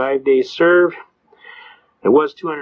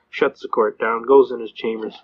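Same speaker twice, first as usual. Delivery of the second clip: usual speaking voice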